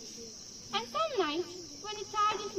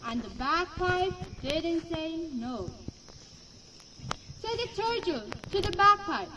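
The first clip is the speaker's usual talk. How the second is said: ordinary delivery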